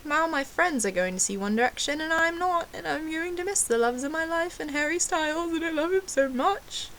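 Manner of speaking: high-pitched mock-tearful lament; hammed-up sadness. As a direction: whine your way through the heartbreak, pitch climbing. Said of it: "So I got very sad".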